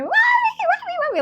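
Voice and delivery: shrill screaming for mommy; little girl screaming in the graveyard; panicked child scream. Retold or imitated. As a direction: scream for your mother in terror. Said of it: "I start freaking out".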